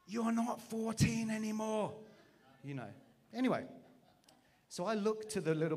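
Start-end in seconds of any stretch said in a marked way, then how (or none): 0.1-1.9 high, slow, drawn-out shout from below; shouted call. Retold or imitated. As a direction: call it up the ramp, stretched and loud. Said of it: "shouts up from the bottom".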